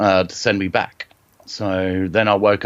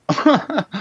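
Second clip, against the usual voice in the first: short laugh; 11 semitones higher than usual. Real, not acted. Laughing at the ghost comparison.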